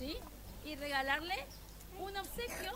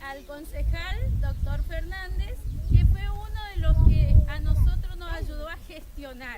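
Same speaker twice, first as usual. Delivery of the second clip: projecting to crowd; 12 dB louder than usual. Real, not acted. Addressing crowd at ceremony.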